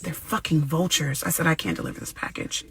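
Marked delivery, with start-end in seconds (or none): 0.0-1.2 muttered, softer, in disbelief; shocked under breath. Retold or imitated. Shocked aside, quieter. 1.5-2.6 whispered, much softer refusal. Retold or imitated.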